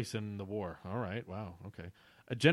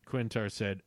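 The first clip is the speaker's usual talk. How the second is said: usual speaking voice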